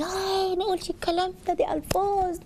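high-pitched mock gasp of dismay; feigned alarm. Shown as a demonstration. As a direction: act shocked by a naughty word, high and soft. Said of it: "وأعمل إن في قلق من الكلمة دي".

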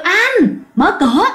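high-pitched call on the first word; wife calling out. A narrator's reading. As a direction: call to husband through the door. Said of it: "mới kêu".

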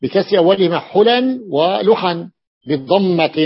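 ordinary delivery

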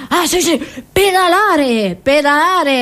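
usual speaking voice

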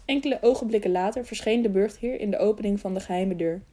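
ordinary delivery

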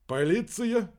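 usual speaking voice

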